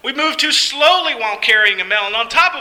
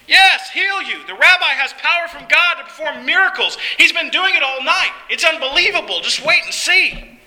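high-pitched, eager insistence; excited assurance; 6 semitones higher than usual. Acted. Friend promising the paralyzed man a miracle, excited. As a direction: convince him with rising excitement, pitch well up.

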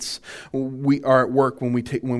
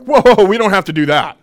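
dismissive coworker; 5 semitones higher, 9 dB louder than usual. Retold or imitated.

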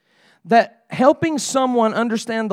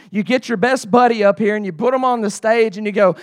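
normal talking voice

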